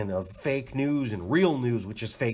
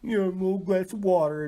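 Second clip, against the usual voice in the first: high mock concerned wife voice; pitched-up caring question; imitating wife; 10 semitones higher, 3 dB louder than usual. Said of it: "she come in and".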